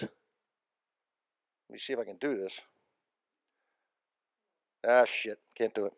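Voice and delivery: low, very soft aside to oneself; muttered aside. Real, not acted. Speaker to himself, checking if he can manage it, muttered. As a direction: mutter it under your breath.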